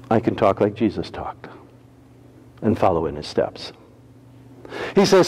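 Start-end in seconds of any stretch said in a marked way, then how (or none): 0.1-3.7 low, soft, sincere; quiet heartfelt conviction; tender preaching. Acted. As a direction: speak it gently to the flock. Softer reflective preaching.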